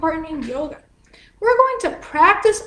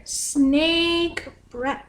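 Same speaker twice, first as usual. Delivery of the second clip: ordinary talk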